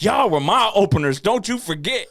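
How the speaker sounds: high, loud, mock-arrogant boast; diva outburst. [name] snapping at the other acts, a high loud diva boast. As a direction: throw a diva fit at the crowd. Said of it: "He really got [name] on everybody".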